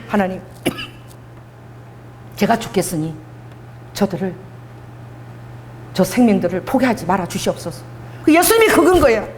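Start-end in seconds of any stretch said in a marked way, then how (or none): 0.1-7.8 low, softened prayer with halting pauses; acted humble prayer, lower and subdued; hushed plea. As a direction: plead quietly, leaving space between phrases. Acting Moses pleading with God.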